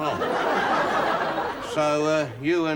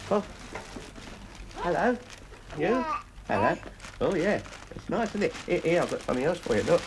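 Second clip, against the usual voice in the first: put-on baby talk; 4 semitones higher than usual. Grandad cooing over the baby.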